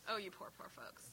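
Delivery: whispered fake sympathy; mock pity whisper. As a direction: breathe it like a mock condolence.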